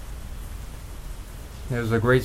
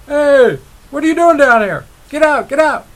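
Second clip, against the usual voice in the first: high, loud mock yell down a hole; calling down; 14 semitones higher, 8 dB louder than usual. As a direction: shout down to the frogs below. Frogs call down into hole.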